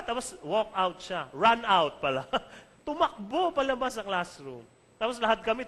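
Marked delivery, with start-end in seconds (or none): none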